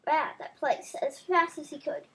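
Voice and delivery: ordinary delivery, nothing marked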